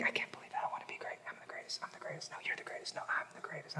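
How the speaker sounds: mock argument in hushed whispers; whispered bickering. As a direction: squabble under your breath, barely audible. Disciples bickering behind Jesus' back.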